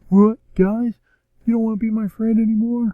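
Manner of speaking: high-pitched mock hurt young hero; young Cyclops. Young Cyclops to the friends leaving him, mock hurt. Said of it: "young Cyclops is like".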